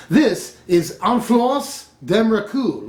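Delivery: slow, put-on French pronunciation; French accent. Shown as a demonstration. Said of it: "sorry about my fake French accent".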